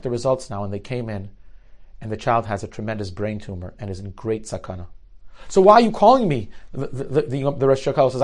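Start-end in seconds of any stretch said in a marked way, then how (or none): 5.5-6.5 exasperated. Retold or imitated. Rosh Kollel woken at 2 a.m.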